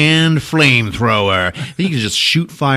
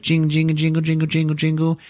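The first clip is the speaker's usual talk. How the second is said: higher, steady jingling chant; imitated bells; 6 semitones higher, 3 dB quieter than usual. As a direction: mimic a shaken bell staff. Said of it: "shaking his staff with the bells".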